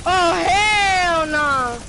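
slow loud hell no; drawn-out shout. Real, not acted. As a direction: stretch the shout out. Sudden enemy appears.

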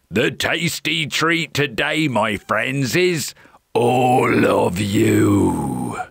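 troll voice slowing to a drawn-out roar; menacing hungry troll. A narrator's reading. Troll reveals he will eat them, menacing drawl. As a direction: relish the threat, stretching the end.

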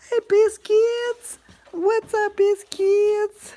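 very high, slow cooing to a dog; sing-song pet voice. Real, not acted.

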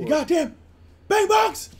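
high, loud scolding holler; hammed-up outraged shout; mock angry yell. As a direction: playfully yell abuse at the audience.

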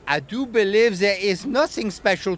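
haughty painter, growing indignant; snooty artist. A narrator's reading. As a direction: sniff at the whole island. Artist figure in story.

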